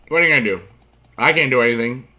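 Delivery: normal talking voice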